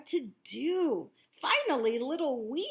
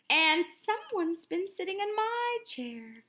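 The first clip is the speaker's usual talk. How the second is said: higher mother bear voice; Mama Bear crying out; 8 semitones higher than usual. A narrator's reading. Narrator voicing Mama Bear, crying out at her chair. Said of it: "cried Mama Bear".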